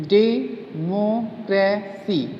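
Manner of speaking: "Democracy" very slow, डे, मो, क्रे, सी; syllable by syllable. Shown as a demonstration. Teacher showing four syllables, slowly separated. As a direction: pause after each of four beats in "democracy". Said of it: "four words".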